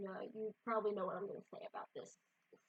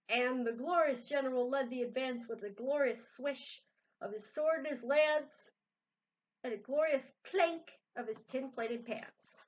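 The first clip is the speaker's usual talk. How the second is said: reading a picture book aloud; lively read-aloud storytelling; story narration; 4 semitones higher, 6 dB louder than usual. A narrator's reading.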